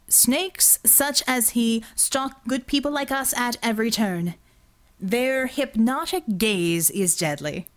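slow, grand preacher-style warning; slow preachy speech. A narrator's reading. Verdigris warning his team through a fake sermon, slow and grand. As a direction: lay it on like a sermon.

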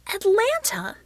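high, loud disbelieving outburst; outraged shout. A narrator's reading. Healer told she must leave, shouting in outrage. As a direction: shout it back in shocked protest. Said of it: "she shouted".

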